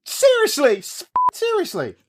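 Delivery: high squeaky disbelief; shrieking disbelief. Acted. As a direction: squeak it out in shock. Disbelief at news.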